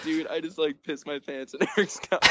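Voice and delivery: lightly voiced apologetic drunk buddy; sheepish drunk friend. Drunk friend confessing, retold.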